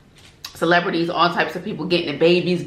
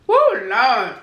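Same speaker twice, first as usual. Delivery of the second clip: loud, delighted food reaction; excited; 5 semitones higher, 3 dB louder than usual. Real, not acted. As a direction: savour it out loud.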